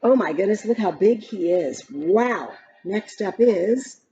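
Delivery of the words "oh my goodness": ordinary delivery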